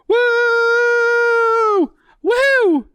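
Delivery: loud, high victory whoop; excited whoop. Real, not acted. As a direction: cheer like you just won. Celebrates surviving covid.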